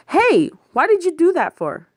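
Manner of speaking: high, sharp indignant call; indignant protest. Acted. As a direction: protest loudly at her. Indignant protest at paper thrown.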